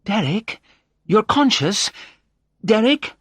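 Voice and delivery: high, growing amazement; surprised. A narrator's reading. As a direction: build in pitch and volume with disbelief. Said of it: "her eyes widening in surprise".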